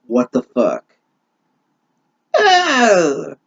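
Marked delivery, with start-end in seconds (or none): 2.3-3.3 a high nasty witch's Ah; sharp, high witch cry. Acted. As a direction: spit it out like a wicked witch. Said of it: "said the witch in a nasty voice".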